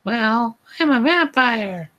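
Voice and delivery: put-on high falsetto girl confession; confessing. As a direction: admit it in a squeaky teen-girl voice. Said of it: "I confessed".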